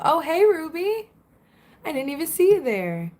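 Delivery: high bright oh hey; surprised greeting. Real, not acted. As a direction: caught off guard, greet her warmly. Spotting a viewer she missed.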